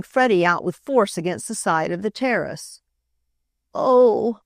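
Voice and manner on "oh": a feeble pained moan. A narrator's reading. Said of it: "Freddie moaned feebly".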